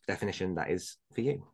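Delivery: ordinary talk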